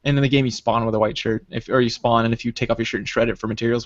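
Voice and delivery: ordinary talk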